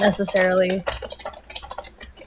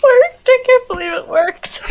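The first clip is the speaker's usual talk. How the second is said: much higher, bursting with disbelief; real excitement; 13 semitones higher, 3 dB louder than usual. Real, not acted. Player thrilled at her lucky roll, high excited disbelief. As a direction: squeal it out, thrilled it worked. Player after a natural twenty.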